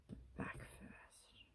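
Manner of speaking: whispered to herself; breathy whisper while concentrating. Real, not acted.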